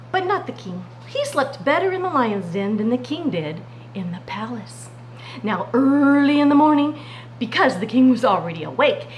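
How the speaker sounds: ordinary talk, nothing marked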